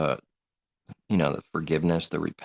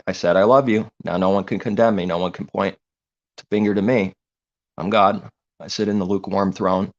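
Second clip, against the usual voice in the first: loud, puffed-up self-proclaimed God; boastful false god; 2 semitones higher, 7 dB louder than usual. Retold or imitated. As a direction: proclaim yourself God with mocking arrogance. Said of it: "which creates an image in the sky".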